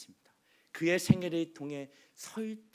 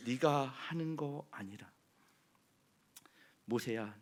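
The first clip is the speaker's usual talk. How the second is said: ordinary delivery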